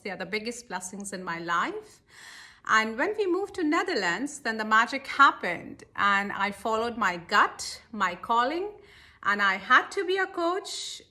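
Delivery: normal talking voice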